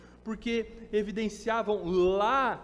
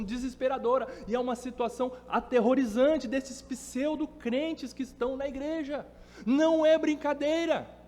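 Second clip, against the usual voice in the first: raised pitch, urgent emphatic sermon delivery; impassioned preaching; 5 semitones higher, 4 dB louder than usual. Real, not acted. Preacher warning the church about false believers, impassioned. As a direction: preach it hard, punching key words.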